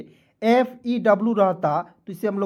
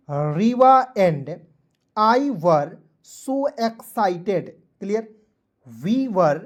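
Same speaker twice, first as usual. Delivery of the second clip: word by word reading; much slower than usual. Shown as a demonstration. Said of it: "इसे पुनः पढ़ते हैं थोड़ा सा फास्ट में".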